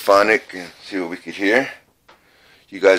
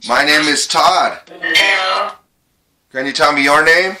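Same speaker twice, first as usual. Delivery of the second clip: calling out; 9 semitones higher than usual. Real, not acted. Calling out to spirits.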